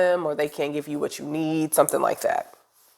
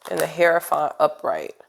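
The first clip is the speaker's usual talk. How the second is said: ordinary talk, nothing marked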